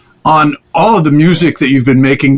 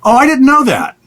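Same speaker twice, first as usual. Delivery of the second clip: genuinely surprised, pitch jumps higher; 10 semitones higher than usual. Real, not acted. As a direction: caught off guard by the news. Host learns new fact.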